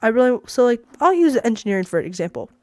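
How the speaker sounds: ordinary talk, nothing marked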